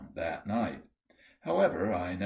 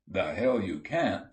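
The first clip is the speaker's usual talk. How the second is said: ordinary delivery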